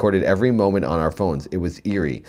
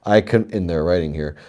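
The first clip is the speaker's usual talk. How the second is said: ordinary talk, nothing marked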